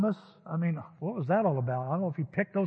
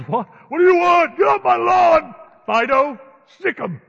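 much higher, much louder furious shout; angry homeowner yell; 11 semitones higher, 14 dB louder than usual. Pretend. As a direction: yell him off the lawn and set the dog on him. Friend plays angry homeowner with dog.

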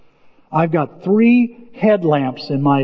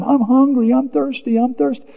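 imitated kid's whine, higher voice; whining child; 6 semitones higher than usual. Child to mother in the desert, mock whining. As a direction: whine it like a child pestering mom. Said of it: "they whine or crying".

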